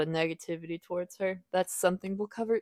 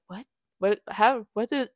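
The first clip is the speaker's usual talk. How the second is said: mock-bewildered stammer, higher pitch; retold confusion; 4 semitones higher than usual. Waking in Lind's arms with no idea how, confused. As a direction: break off each question, as if lost for words. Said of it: "she's genuinely so confused".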